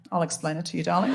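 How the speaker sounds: low, soft smooth offer; seductive mansplainer. Retold or imitated. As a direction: imitate a man sweetly condescending, dropped low. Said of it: "The accent's seductive".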